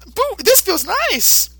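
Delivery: put-on very high, slow childlike creature voice; Boo voice. Boo cooing happily to the puppy, in a very high slow voice. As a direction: coo it like a tiny pink monster.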